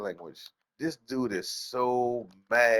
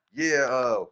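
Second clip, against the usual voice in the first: normal talking voice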